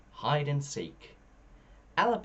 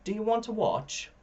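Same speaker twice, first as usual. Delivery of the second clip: put-on young unicorn; 12 semitones higher than usual. A narrator's reading. Young unicorn's eager question.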